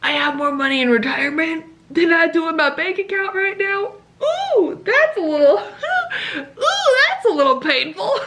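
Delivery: rueful giggle; pained laughter. Retold or imitated. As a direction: wince and laugh. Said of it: "and I'm like".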